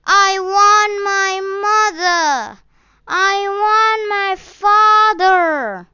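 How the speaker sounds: high, loud wailing sob; lost boy wailing for his parents; crying child. A narrator's reading. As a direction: cry it out through tears. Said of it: "continued crying".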